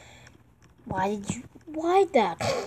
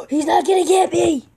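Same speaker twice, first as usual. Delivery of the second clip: excited shout; 6 semitones higher, 6 dB louder than usual. Real, not acted. Excited shout about being safe.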